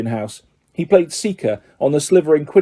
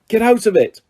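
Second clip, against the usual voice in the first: sharp, higher angry outburst; boy snapping at a pet; angry shout; 8 semitones higher than usual. A narrator's reading. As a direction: shove the words at the cat. Ron shoving the cat away.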